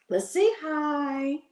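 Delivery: high, drawn-out coo to a pet; pet talk. Real, not acted. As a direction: coax a pet slowly in a high voice. Coaxing pet to say hi.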